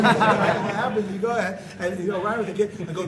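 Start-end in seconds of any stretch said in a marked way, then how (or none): none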